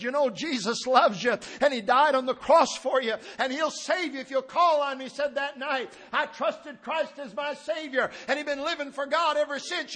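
high preaching swelling to a shout; building preaching climax. Real, not acted. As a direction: drive the testimony home to the congregation.

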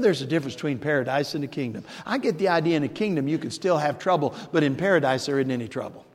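ordinary delivery

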